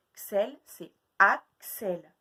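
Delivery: drawn-out, slow answer; slow reveal. Shown as a demonstration. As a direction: reveal the full name slowly. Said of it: "je vais vous dire de nouveaux prénoms".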